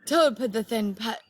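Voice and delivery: ordinary delivery, nothing marked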